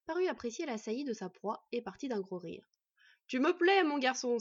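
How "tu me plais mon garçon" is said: big, loud, amused approval; hearty exclamation. Acted. Bandit amused by his prey's cheek, exclaiming. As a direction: boom it out heartily. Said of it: "s'exclama-t-il".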